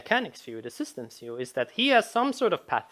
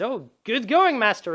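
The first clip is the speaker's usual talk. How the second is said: higher-pitched rabbit sidekick praising the hero; cheery rabbit companion; 7 semitones higher, 3 dB louder than usual. Acted. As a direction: play a lightly voiced chirpy rabbit sidekick. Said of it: "and he says".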